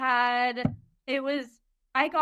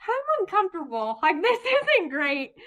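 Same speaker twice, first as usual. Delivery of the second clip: mock uncomfortable whine; 7 semitones higher, 4 dB louder than usual. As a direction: play up the discomfort with a laugh. Retelling her own complaint in labour.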